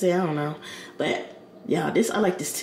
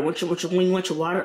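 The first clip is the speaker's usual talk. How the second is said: quick, tripping restarts on "When you"; stuttering; faster than usual. Real, not acted. Explaining flavored water to viewers, stuttering. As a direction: stumble over the opening words, repeating them rapidly. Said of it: "I'm stuttering".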